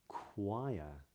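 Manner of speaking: "choir" as k, drawn-out oo, then ire; rounded W glide. Shown as a demonstration. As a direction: stretch the hidden W in "choir". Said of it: "Even words like".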